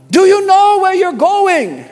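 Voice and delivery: high, loud, slow call to the driver. Retold or imitated. Huxley calls out to driver.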